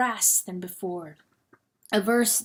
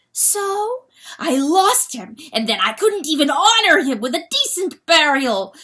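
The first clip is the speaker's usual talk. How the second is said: defiant; 7 semitones higher, 6 dB louder than usual. Acted. Hen answering back.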